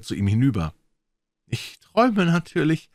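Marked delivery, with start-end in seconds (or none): 1.5-2.9 high, louder dazed remark; dreamy loud blurt; said aloud. A narrator's reading. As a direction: blurt it out in disbelief. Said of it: "sagte er laut".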